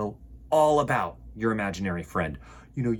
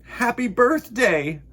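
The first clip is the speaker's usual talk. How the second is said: Bear's voice; 9 semitones higher, 6 dB louder, slower than usual. Acted. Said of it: "said Bear".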